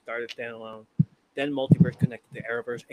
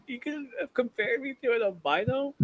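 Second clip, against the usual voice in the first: much higher, outraged put-on voice broken by a laugh; mock outraged troll; 13 semitones higher than usual. Retelling a livestream, the troll is furious at being compared to an albino, in a much higher mock outrage. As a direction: ham up the insulted guy losing it.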